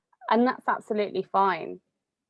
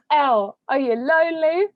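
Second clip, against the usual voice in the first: higher, louder mock mum with a rising question; imitating mum; 8 semitones higher, 7 dB louder than usual. Mum running through her checklist with her daughter, higher mock voice. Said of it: "And she goes".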